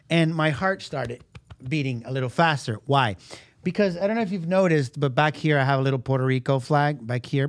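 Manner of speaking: ordinary delivery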